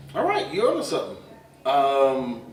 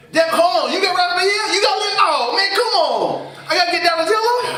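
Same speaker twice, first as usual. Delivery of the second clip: much higher, louder whiny complaint; disappointed kid; 12 semitones higher, 5 dB louder than usual. Retold or imitated. Kid who picked last stuck with Donatello, loud high whine. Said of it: "the last one who picked was like".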